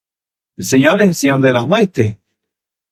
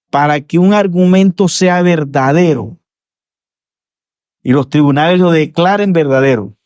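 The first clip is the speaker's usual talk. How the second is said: higher, driving sermon voice; fervent preaching; 5 semitones higher than usual. Acted. Preacher arguing to the congregation, preaching at full force. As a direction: press the argument home like in a courtroom.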